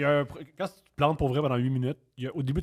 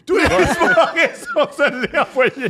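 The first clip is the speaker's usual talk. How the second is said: high, slow laughing words; 10 semitones higher, 5 dB louder, much slower than usual. Real, not acted. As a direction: laugh through the line. Laughing while telling the story.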